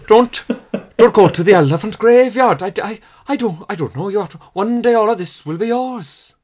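lightly Welsh lion king, loud and fatherly; Welsh accent as Mufasa. Pretend. Friend does Welsh Mufasa for the panel, hammed-up Welsh accent. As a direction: Mufasa warning Simba in a hammed-up Welsh lilt. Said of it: "It sounds like he grew up somewhere else".

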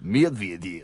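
deep, low Russian accent; deep mock-Russian pronunciation. Shown as a demonstration. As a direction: put on a low, soft Russian voice. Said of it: "unless you say it in a Russian accent".

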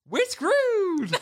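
high, laughing, forced-cheerful; mock upbeat. [name] forcing cheer after the pep talk, laughing. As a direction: chirp it brightly through a laugh.

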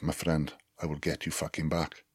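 low-volume threat in a mock South African accent. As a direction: imitate a South African, softly menacing. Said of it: "not really good at doing a South African accent".

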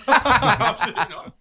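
loud laughing refusal. Real, not acted. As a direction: laugh it out loudly. Laughing reaction.

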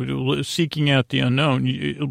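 normal talking voice

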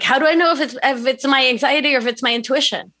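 mock anxious client asking. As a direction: rush it like a fretting client. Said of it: "if it's talking like that, that's anxiety".

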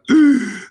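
sharp, high shocked gasp; loud gasp. Retold or imitated. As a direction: gasp loudly, re-enacting the shock. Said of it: "so, like, gasps".

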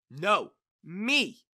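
jealous outburst, voice up; exclaiming. A narrator's reading. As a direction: exclaim it, insisting on being picked. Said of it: "exclaimed Mary".

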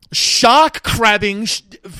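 slow, high mock swearing rant; swearing tirade. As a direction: fake swear words spat out one by one. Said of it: "replace every swear word".

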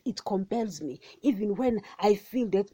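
ordinary talk

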